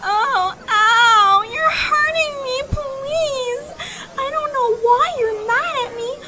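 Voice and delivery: high whimpering fake distress; fake crying in pain. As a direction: play the damsel in distress. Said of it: "She's the one faking it".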